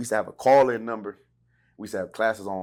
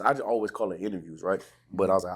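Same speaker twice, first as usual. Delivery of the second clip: ordinary talk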